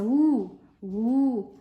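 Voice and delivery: long, loud U, held as a vowel sound; U said twice, stretched and emphatic; sounding out a letter. Acted. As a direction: draw out each U like a pupil sounding it. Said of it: "Então, trabalha com som".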